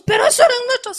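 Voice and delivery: high, shocked protest; mother objecting, high and shocked; indignant mother. Retold or imitated. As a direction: cry out at the son in disbelief.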